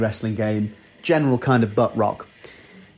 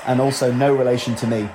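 normal talking voice

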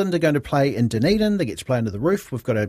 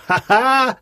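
a high, loud teasing ha ha; mocking cackle; 11 semitones higher, 5 dB louder than usual. Backyard cricketer mocking the batter, high cackling ha ha. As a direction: cackle like the cheeky backyard bowler. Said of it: "does the old".

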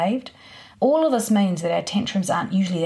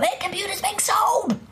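high-pitched, strained parent's outburst; hammed-up parent losing their rag; mock angry threat; 13 semitones higher than usual. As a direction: lay down a wild threat, pitched way up. Angry parent's over-the-top threat voiced.

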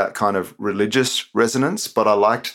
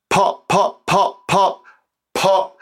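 popping cereal sounds; 11 semitones higher, 4 dB louder, much slower than usual. Acted. Cereal noises in poem.

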